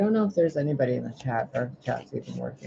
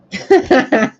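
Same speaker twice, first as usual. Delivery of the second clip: high, loud cackling laugh; burst of laughter; 11 semitones higher, 13 dB louder than usual. Real, not acted. Laughs after a dare.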